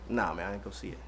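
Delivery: hushed, low aside to a friend; low-key reply. Acted. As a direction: drop right down into a quiet, casual answer. Acts out declining reply to friend.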